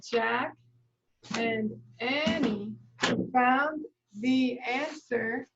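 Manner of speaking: slow, one word at a time; word-by-word writing pace; slow dictation. Shown as a demonstration. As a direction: say each word as you write it. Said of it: "writing word by word".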